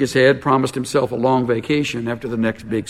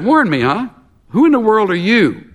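higher, scoffing challenge, lightly voiced; a hint of a cocky thief; burglar; 8 semitones higher, 4 dB louder than usual. A narrator's reading. Burglar scoffs at the warning parrot, higher mocking challenge.

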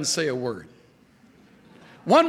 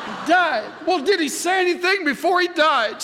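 surprised store owner; 12 semitones higher, 3 dB louder than usual. Retold or imitated. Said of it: "The guy says".

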